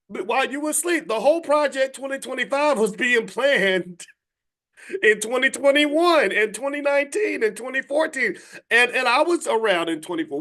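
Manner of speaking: high-pitched, incredulous rant; exasperated scolding. Real, not acted. Speaker to people who slept through the plan, high exasperated scolding.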